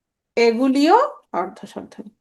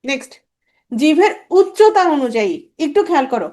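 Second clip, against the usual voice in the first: ordinary delivery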